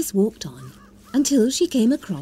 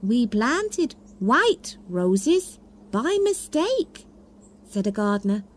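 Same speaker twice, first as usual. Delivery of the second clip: 1 gardener; 5 semitones higher, slower than usual. Acted. Gardener card voiced.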